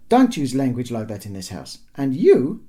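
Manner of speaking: loud telling-off; scolding mother. A narrator's reading. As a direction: scold the children loudly. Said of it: "screamed the mother".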